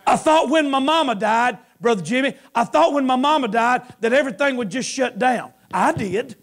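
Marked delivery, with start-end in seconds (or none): none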